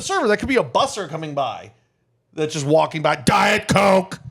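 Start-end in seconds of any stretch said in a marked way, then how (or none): 3.2-4.2 higher, drawn-out customer call; mock rude customer blurting DIET COKE; brusque drink order. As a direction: cut in with a loud, slow order. Imitating customer barking drink order.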